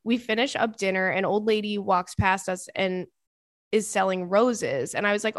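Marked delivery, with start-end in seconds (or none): none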